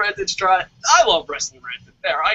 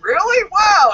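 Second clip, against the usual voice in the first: high, loud, gushing wow; overplayed excitement; 13 semitones higher, 6 dB louder, slower than usual. Author on the Today Show gushing with overplayed excitement. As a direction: oversell the thrill for the camera. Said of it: "And I'm all excited".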